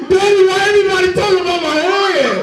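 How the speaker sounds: put-on high whiny little boy asking daddy; kid voice. Little boy asking his daddy why everyone talks about his head. Child self whining to daddy.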